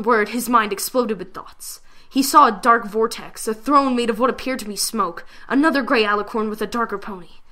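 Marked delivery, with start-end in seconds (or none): none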